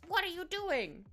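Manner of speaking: put-on high, loud British accent asking Mummy; British cat voice. Voicing her old cat Wolfie in a British accent. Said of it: "like, a British thing".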